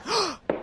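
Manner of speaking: a quick, high startled gasp; sudden gasp. Acted. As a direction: catch your breath at a surprise. Spots someone coming.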